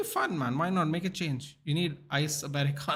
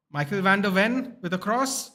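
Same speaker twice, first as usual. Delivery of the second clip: excited; 6 semitones higher, 6 dB louder than usual. Real, not acted. Reacting to a live match.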